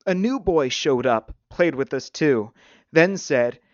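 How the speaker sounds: put-on upset boy blurting in short bursts; short spurts. A narrator's reading. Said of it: "voice came in short spurts".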